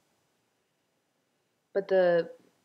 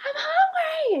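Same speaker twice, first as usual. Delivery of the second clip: put-on very high, loud toddler shriek; tantrum scream; 12 dB louder, slower than usual. Throwing a tantrum at her husband, shrill hungry scream. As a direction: scream it like a three-year-old in a tantrum. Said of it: "like, screaming".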